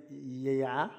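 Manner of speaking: low, soft, rising yeah; tentative, quiet reply to an official; retold hesitant yes. Retold unsure answer to official.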